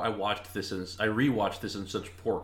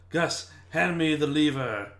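slow, higher bossy magician command; mock magician; 7 semitones higher, 4 dB louder, slower than usual. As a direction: order the cat around slowly like a pompous magician. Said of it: "He would just go".

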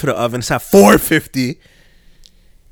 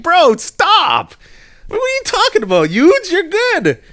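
high, loud squeaky "bro, stop, you're good"; excited high reassurance; 4 dB louder, slower than usual. Retold or imitated. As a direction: brush off the apology in a squeaky high voice.

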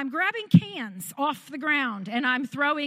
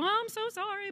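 much higher, gushing apology; flustered sorry; 10 semitones higher, 4 dB quieter than usual. Retold or imitated. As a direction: squeak out the sorry to the delivery men. Apologizing to Lowe's men, stressed.

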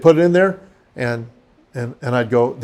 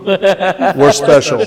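laughing; 5 dB louder, much slower than usual. Real, not acted. Joking, laughing.

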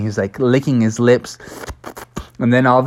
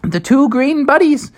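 teacher voice; 13 semitones higher, 3 dB louder than usual. Acted. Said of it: "the teacher was like".

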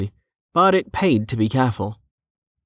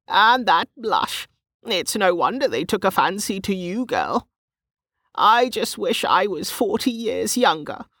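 put-on cackling old woman; 11 semitones higher than usual. A narrator's reading. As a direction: gush over her in a high old-woman voice. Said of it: "Gemma cackled".

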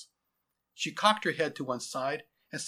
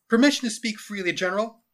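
high, loud military request; formal lieutenant; 8 semitones higher, 9 dB louder than usual. Acted. Lieutenant asking his general to speak freely, formal. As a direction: ask leave like a soldier at attention.

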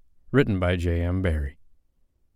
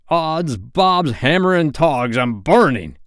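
loud, high pirate yelp; alarmed outcry; 7 semitones higher, 6 dB louder, slower than usual. A narrator's reading. Hook jumping off the burning seat, loud alarmed cry. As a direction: burst out as if scorched.

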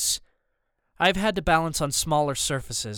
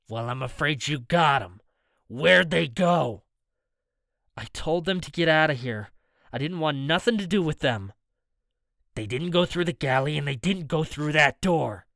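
menacing thug voice. Acted. Rooster threatening Anthony.

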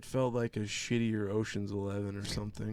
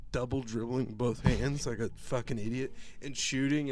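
ordinary talk

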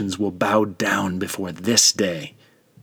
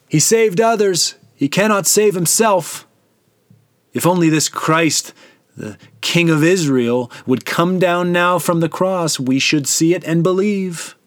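high, loud sneering taunt; scornful mockery; 8 semitones higher, 4 dB louder than usual. A narrator's reading. Chief priests mocking the crucified son, high loud sneer. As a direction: scoff at him as if he's a fraud. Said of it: "made fun of him among themselves".

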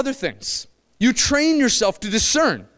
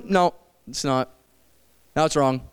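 much lower, softer, calm authoritative pastor voice; pastor's reply; 10 semitones lower, 3 dB quieter than usual. Retold or imitated. Preacher imitating Pastor Jerry answering, low and calm. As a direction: drop low and settle it like the wise pastor.

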